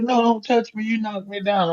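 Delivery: put-on angry old lady. Old lady on the floor refusing his help, mock angry and high.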